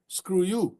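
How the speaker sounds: ordinary talk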